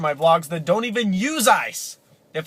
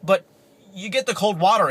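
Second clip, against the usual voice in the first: ordinary talk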